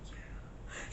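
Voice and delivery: barely audible whispered reply; retold whisper. Answering her friend while hiding, retold whisper. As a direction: answer under your breath. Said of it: "it was like S M R the whole time".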